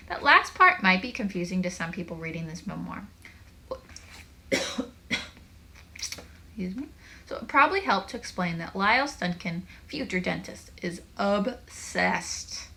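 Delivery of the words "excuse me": plain reading voice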